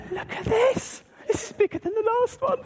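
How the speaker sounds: very high, excited squeal of words; hammed-up amazement. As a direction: play up wide-eyed wonder for children. Acts amazement at bigger present.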